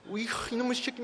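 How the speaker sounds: high, strained burst of exasperation; mock losing temper. Father retells snapping at his crying daughter, acted exasperated outburst. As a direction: blow your lid, acted out. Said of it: "제 뚜껑이 열렸습니다".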